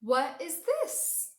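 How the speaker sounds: loud, high, slow storybook question; drawn-out, rising amazement; wondering surprise. A narrator's reading. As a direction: ask it like a startling discovery. Discovering something in the story.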